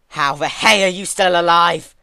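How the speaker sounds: high, loud furious demand. A narrator's reading. Twilight demanding answers from the revived Celestia. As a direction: bark the question at her. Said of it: "Twilight demanded to know".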